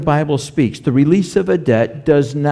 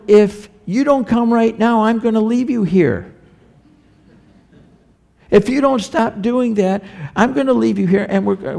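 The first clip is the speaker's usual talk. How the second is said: a stern parent's empty threat, pitched higher; parent threatening child; 7 semitones higher than usual. Retold or imitated. Parent warns a misbehaving child in the store, mock stern.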